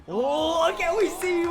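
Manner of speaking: usual speaking voice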